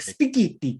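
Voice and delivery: "Spaghetti" high and Italian style; "Spaghetti" in a hammed-up Italian way; Giotto's Italian spaghetti. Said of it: "Or when she's like".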